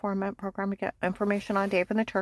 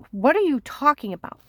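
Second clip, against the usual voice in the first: "What are you talking about?" higher and louder, baffled; loud confused retort; 6 semitones higher, 9 dB louder than usual. Retold or imitated. Her confused retort, retold.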